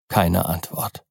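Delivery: plain reading voice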